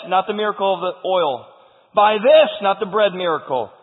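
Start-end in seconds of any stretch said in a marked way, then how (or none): 1.9-2.5 loud, higher, emphatic cry; insistent cry of conviction. Acted. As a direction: punch THIS high and loud. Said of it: "she cries out to Elijah".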